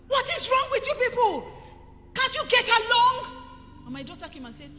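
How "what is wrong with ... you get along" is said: high loud yell; shouting at children. Retold or imitated. Mother scolding quarreling children, loud high yell. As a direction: yell across the house at them. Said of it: "I was shouting from where I was".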